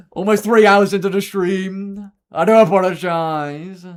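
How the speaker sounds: loud, high and slow, hammed-up; mock shouting apology. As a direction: boom the apology out slowly. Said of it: "Sorry, I'm not shouting".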